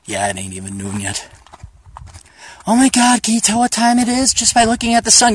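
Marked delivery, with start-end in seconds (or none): 2.7-5.3 high-pitched imitation of impressed ladies; playfully mocking wide-eyed amazement; mock amazed admirer. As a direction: gush in a put-on higher voice.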